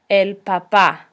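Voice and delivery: papá with the final pá stressed and dropping; stress on second A. Shown as a demonstration. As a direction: hit the last syllable of papá. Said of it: "accent on the second A".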